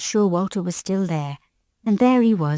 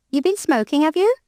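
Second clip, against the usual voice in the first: rising, higher, mock accusing; suspicious collector; 8 semitones higher than usual. A narrator's reading. Ticket collector doubting a young girl smokes, suspicious. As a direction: raise it up in disbelief.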